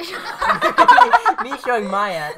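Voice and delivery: high, slow laughing correction. Real, not acted. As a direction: laugh it out hard.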